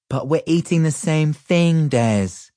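lightly voiced patient big brother; a hint of big brother explaining; older brother voice. A narrator's reading. Big brother explains calmly to toddler Des. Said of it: "I said in my older brother voice".

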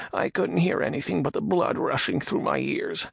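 high nervous man voice; frightened Radagon. Acted. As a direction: ask in timid fear.

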